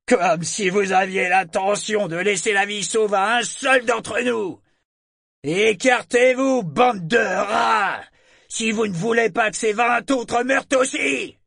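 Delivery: high, loud retort across the water; shouted defiance. A narrator's reading. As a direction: shout them off with a threat, drawing out the insult. Enemy captain shouts back threat. (réplique hurlée, menaçante)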